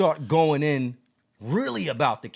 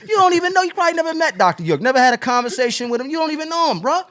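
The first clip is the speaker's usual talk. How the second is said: much higher, louder and heated; heated retort; 10 semitones higher, 5 dB louder, faster than usual. Real, not acted. Host scolds the commenter who never met Doctor York, voice much higher and louder. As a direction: fire back at him, voice jumping up high.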